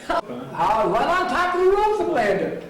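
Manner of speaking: high, drawn-out storyteller's whoop; amused exclamation. Real, not acted. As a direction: stretch it upward with a laugh, marvelling. Relishing his retold home-run story.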